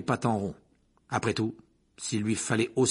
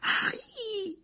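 Hermione's moan; 4 dB quieter than usual. Acted. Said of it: "gémit Hermione".

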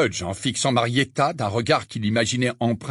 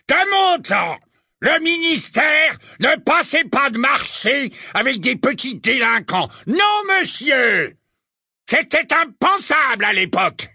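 put-on much higher, louder blustering old wizard; roaring rallying speech; 5 dB louder than usual. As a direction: bellow like an outraged portly portrait. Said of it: "rugit le portrait du corpulent sorcier".

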